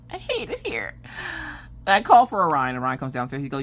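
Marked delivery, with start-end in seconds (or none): 0.1-0.9 soft, high squeaked lament; mock despair. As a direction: squeak it as if about to cry. Acted despair after laugh.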